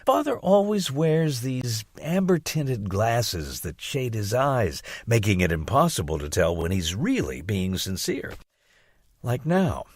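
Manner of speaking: ordinary delivery, nothing marked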